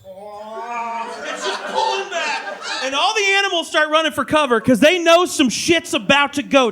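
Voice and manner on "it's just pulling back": slow, soft, stretched "pulling back"; drawn-out. Acted. Describing the shoreline before a tsunami, drawn out. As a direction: let the words recede slowly.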